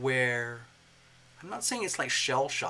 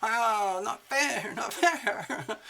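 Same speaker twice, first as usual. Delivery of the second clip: higher, louder mock whine with a laugh; whiny protest; 8 semitones higher, 3 dB louder, much slower than usual. Libra feeling cheated of her birthday, higher laughing whine. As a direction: whinge like a cheated child. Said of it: "wait a minute".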